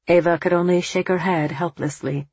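ordinary delivery

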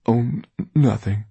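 hesitant, evasive stammer. A narrator's reading. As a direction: dodge the question nervously. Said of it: "Darius had said evasively".

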